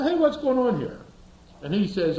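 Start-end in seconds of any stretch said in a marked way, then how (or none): 0.0-1.0 high, loud hey; indignant protest. Retold or imitated. Driver protesting to cop giving a ticket, loud high hey.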